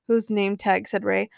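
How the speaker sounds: ordinary delivery, nothing marked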